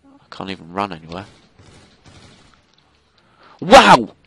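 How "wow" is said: loud, high cry of disbelief; exasperated shout. Real, not acted. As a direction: burst out in outraged disbelief. Disbelief at the game.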